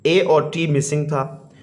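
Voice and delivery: usual speaking voice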